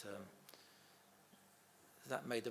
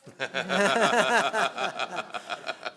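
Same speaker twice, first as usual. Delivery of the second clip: big loud burst of laughter; 7 semitones higher, 14 dB louder than usual. Real, not acted. Son laughing at his father's prank on the producers, a loud laugh. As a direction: laugh out hard at the punchline. Laughs at own story punchline.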